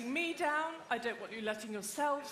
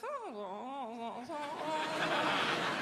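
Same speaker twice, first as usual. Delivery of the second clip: long wordless upper-class mumbling; posh mumble; 3 semitones higher than usual. Shown as a demonstration. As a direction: drone posh noises through a nearly closed mouth. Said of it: "that's the posh mumble".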